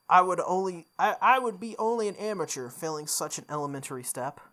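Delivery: usual speaking voice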